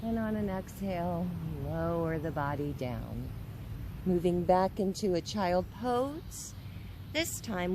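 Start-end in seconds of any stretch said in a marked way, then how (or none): none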